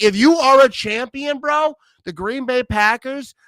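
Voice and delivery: usual speaking voice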